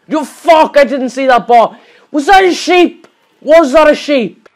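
loud, high, disbelieving yell; real outrage, shouted high and loud; furious shout. Real, not acted. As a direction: bellow at the sheep that blew you up. Ambushed by an exploding sheep.

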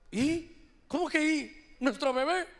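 mock-indignant wife correcting her husband; incredulous wife. Wife answers her clueless husband.

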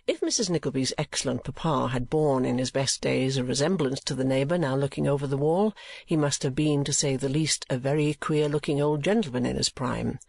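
usual speaking voice

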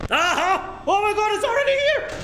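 high, fast scared shriek; terrified it's already there; panicked scream. Real, not acted. Animatronic suddenly appears, panics.

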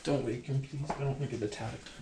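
ordinary talk, nothing marked

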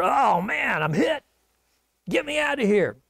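hammed-up wail of someone hit; crying out wounded. Wounded Ahab to his driver, mock distress. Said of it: "cries to his driver".

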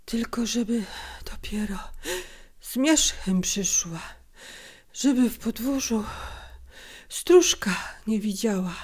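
breathy, gasped fragments; tired, broken voice. A narrator's reading. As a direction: push words out between laboured breaths. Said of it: "zmęczonym, przerywanym głosem".

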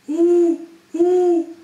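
high, loud hooting; owl hoot. Shown as a demonstration. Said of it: "然后发生共鸣".

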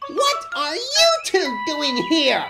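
slow, high furious shout; high-pitched, loud outburst of fury. A narrator's reading. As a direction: bellow it in outrage, drawing out each word. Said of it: "looking absolutely furious".

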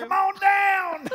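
very high, stretched-out holler; hammed-up high, slow shout; game-show shout. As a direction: yell it like an announcer calling a contestant. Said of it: "He's like".

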